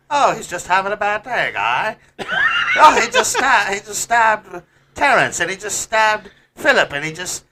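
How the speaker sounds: shrill mock-cheerful Canadian bystander; South Park Canadian. Canadian bystanders shrugging off a stabbing in South Park accent. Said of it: "you sound like a guy from South Park".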